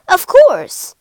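loud, high "of course"; booming ready agreement; emphatic assent. A narrator's reading. King grants the monkey's request.

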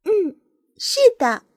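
high, lightly voiced bird; a hint of kindly nightingale; nightingale answering. A narrator's reading. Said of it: "夜莺回答".

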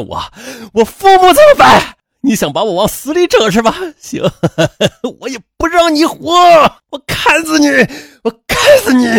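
frenzied high-pitched screaming with bitter outbursts; enraged roar. A narrator's reading. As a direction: lash out in hysterical rage, strained to breaking. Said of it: "边砍边大吼道". (歇斯底里地怒吼)

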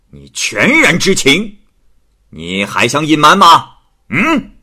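bully her into confessing; stern bluff. Acted. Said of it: "吴太守骗他说". (太守虚张声势地恐吓王氏)

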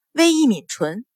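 usual speaking voice

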